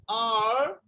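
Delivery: normal talking voice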